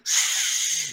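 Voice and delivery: soft hushing shh; shushing. Retold or imitated. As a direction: hush someone quietly. Imitating people hushing.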